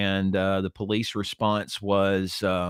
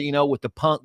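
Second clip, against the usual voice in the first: normal talking voice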